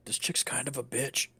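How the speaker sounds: low, hushed confiding remark; quiet whisper. Acted. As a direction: lean in and say it under your breath. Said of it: "I whisper really quietly into his ears".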